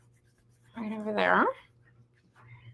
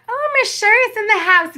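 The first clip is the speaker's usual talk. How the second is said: high, loud shout-out; excited shout-out; 11 semitones higher, 8 dB louder than usual. Real, not acted. Crafter spotting viewer Miss Sherry in chat, high loud shout-out. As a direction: hail her arrival like a party host.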